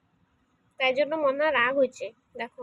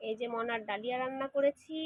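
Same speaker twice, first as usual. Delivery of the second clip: ordinary talk, nothing marked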